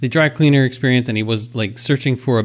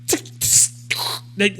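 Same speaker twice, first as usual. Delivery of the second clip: soft unvoiced tick tick of a machine; a clicking conveyor noise, whispered; 7 semitones higher, 5 dB quieter, much slower than usual. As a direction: whisper the ticks like gears clicking. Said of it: "it gets to your thing".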